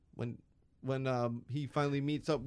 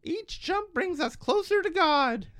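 put-on much higher, slow child's voice; pious little boy, pitched way up; kid voice; 3 dB louder, slower than usual. Cohost tries Simpsons kids, slow high child voice. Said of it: "Todd or".

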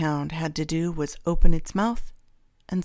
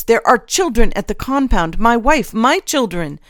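loud, heated pleading, building higher; alarmed protest; 3 semitones higher, 9 dB louder than usual. A narrator's reading. Gage pleads for his wife and children, alarmed. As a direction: build to a raised-voice plea.

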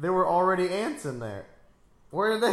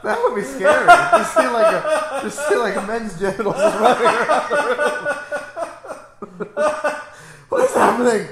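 high, loud, laughing words; losing it with laughter; laughing hysterically; 8 semitones higher, 13 dB louder than usual. Real, not acted.

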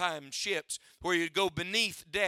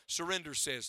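ordinary talk

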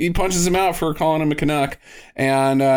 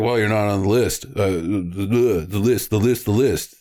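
dim bouncer; 6 semitones lower than usual. Retold or imitated. Acting the list-obsessed bouncer.

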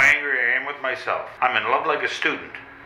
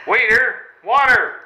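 loud, higher panicked call; 9 semitones higher, 6 dB louder, slower than usual. Acted. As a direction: shout for water, flustered. Calling out in panic.

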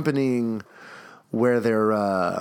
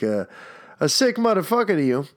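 ordinary talk, nothing marked

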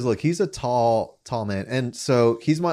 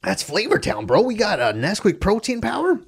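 high surfer-dude TV chef; brash TV chef hype; Guy Fieri; 9 semitones higher than usual. Acted. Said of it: "looked at how he talked".

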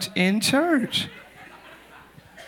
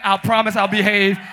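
ordinary talk, nothing marked

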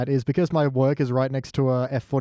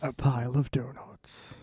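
movie trailer voice; 4 semitones lower, 8 dB quieter than usual. Acted. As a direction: drop the voice for a film trailer. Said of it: "like, a trailer".

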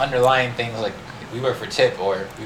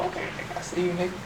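hushed props; 6 semitones higher, 11 dB quieter, faster than usual. Acted. Giving props to a coworker at work, hushed. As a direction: say it under your breath, impressed.

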